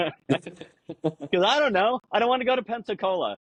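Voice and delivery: high, stretched bewildered pilot; mock flustered pilot voice; confused pilot. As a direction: play the pilot as baffled and lost. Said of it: "he goes".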